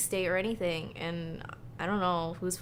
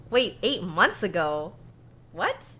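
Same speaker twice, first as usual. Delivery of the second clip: surprised; 3 semitones higher, 3 dB louder than usual. Real, not acted. Finds the game came out long ago.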